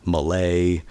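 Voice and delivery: Malay said as muh-LAY; mispronounced surname. Shown as a demonstration. Singer showing another misreading of his surname, Malay as muh-LAY. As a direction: end Malay on a long "lay". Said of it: "you, I would get".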